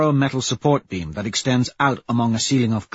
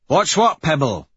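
high, louder impatient question; 6 semitones higher, 4 dB louder than usual. A narrator's reading. Severo waiting on Pebble at the datapad, impatient. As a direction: press for the answer with impatience. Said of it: "he asks impatiently".

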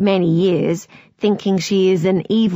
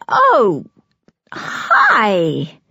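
falsely bright. A narrator's reading. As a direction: fake a bright greeting. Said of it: "she says brightly and falsely".

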